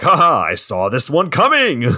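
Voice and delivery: playful imitated gloating; mock triumph. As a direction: crow it as a hammed-up gotcha. Acting out triumph after stabbing.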